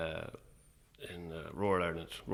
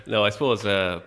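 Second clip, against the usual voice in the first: words through real laughter, much louder; laughing; 2 semitones higher, 13 dB louder than usual. Real, not acted. Guest reining in his laughter after joking, laughing through the words. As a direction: still laughing as you settle down.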